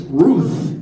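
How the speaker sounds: lightly barked word; barking dog. Acted. Comedian gives the joke dog's answer, lightly barked. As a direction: say it as a dog's woof. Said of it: "And the dog says".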